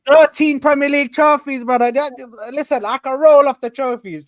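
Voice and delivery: loud, high triumphant boast. Real, not acted. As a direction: shout the trophy count at your rival. Boasting fan shouting trophy count.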